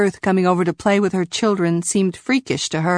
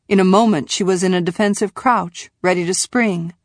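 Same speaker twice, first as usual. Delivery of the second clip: ordinary delivery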